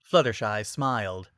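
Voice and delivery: ordinary delivery, nothing marked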